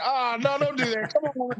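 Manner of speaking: high-pitched comic begging; mock pleading. Son begs mom not to stop watching, pleading.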